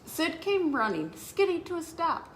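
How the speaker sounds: put-on bouncy storytime narration for kids; animated children's story narration; lively read-aloud. A narrator's reading. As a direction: read it like a picture book to children. Reads picture book aloud.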